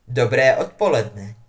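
a smiling, kindly welcome. A narrator's reading. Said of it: "popřál jim vlídně a usmíval se". (vlídně s úsměvem)

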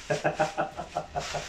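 breathy villainous cackle; a wicked little laugh. Acted. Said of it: "Qué hermosa risa de villano".

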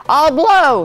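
loud, high declaration; shouting. A narrator's reading. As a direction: shout it out boldly. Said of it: "he shouted".

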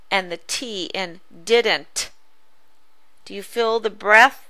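usual speaking voice

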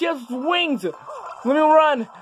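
excited gamer yelling. Real, not acted. Player yelling at winged enemies in the game, giddy and frantic. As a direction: cry out in giddy mock outrage.

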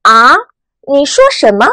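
啊 rising like a puzzled question. Shown as a demonstration. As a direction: ask 啊 with a rise, not catching it. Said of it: "声音往上扬的". (啊，第二声，疑问语气)

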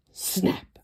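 sharp hushed snap; gasp then whispered snap. A narrator's reading. Storyteller gasping then whispering a snap. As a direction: voice the sound effect in a whisper.

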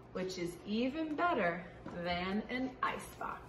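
put-on reading a picture book aloud to kids; story reading. Teacher reading a picture book to children, lively storytime. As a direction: read it out brightly for young listeners.

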